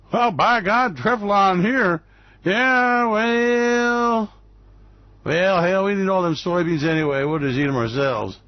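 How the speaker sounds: a hint of a hammed-up country farmer; mock farmer. Host imitating a farmer shrugging off soybean tariffs.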